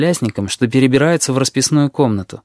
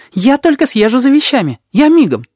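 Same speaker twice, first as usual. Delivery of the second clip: put-on high, eager boy's burst; excited kid; 13 semitones higher, 5 dB louder than usual. A narrator's reading. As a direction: blurt it out thrilled. Вонючка thrilled.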